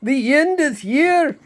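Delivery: high, loud squealing fish voice; screaming fish. A narrator's reading. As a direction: shriek it like a panicked talking fish. Fish repeats its warning.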